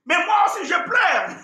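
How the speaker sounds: mock whining kid voice, high; child's retort. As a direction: answer back like a cheeky child. Child answering father back.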